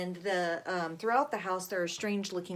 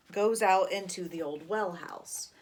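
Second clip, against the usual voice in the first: normal talking voice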